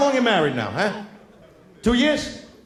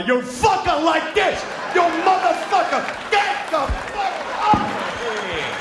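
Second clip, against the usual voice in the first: much higher, slow wild yells; wild wife yelling; 10 semitones higher, slower than usual. Retold or imitated. Wife crying out at husband in bed, much higher and wild. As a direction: scream it like she's lost it in the heat.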